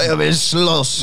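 high, loud, dragged-out brawler's yell; hammed-up stoner picking a fight; mock tough guy. Mimicking a stoned, aggressive smoker.

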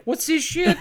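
high mock clown voice, indignant; a lightly hammed Pennywise griping. Pennywise watching the movie at home, high mock outrage. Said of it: "imagine Pennywise watching at home is like".

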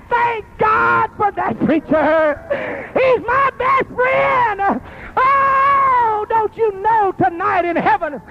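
weeping altar-call climax, drawn out and high; crying preacher. Acted. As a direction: cry it out to the congregation.

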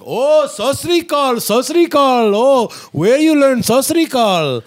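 put-on high, loud delighted Indian-accented outburst; excited Indian man. Punjabi speaker amazed you learned the greeting, excited Indian accent. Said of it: "they're, like, so excited".

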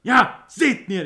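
high, loud triumphant yell; imitating a friend's triumphant gamer yell; gloating cheer. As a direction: crow over the kill like a gamer who finally got him. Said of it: "he just goes like".

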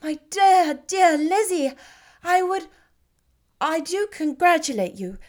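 rushing to wish her joy, then worrying; Jane, overjoyed yet anxious. Acted. Jane congratulating, questioning.

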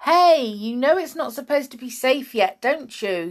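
high, loud challenge called across a pond; storyteller's yell. A narrator's reading. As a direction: call out a sharp reproach. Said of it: "yelled Tom".

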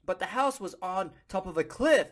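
usual speaking voice

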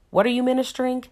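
ordinary delivery

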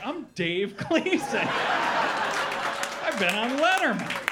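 slow, swaggering boast; cocky attitude. Acted. Comedian strutting around town as Dave Gleason, cocky attitude. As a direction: strut through it, mock self-important. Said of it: "strutting around town with this attitude".

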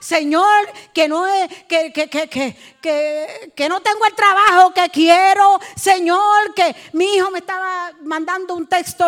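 loud, high, stammering plea; hammed-up crying out to the Lord; mock pleading prayer. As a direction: parody someone begging God for petty needs. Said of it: "no es esa oración de pizza".

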